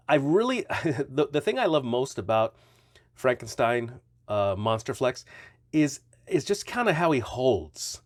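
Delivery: normal talking voice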